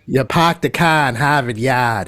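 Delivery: parked the car in Harvard Yard with every r dropped, pahked the cah in Hahvahd Yahd; playful Boston accent. Shown as a demonstration. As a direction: put on a broad Boston accent for the old catchphrase. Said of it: "if you're a native".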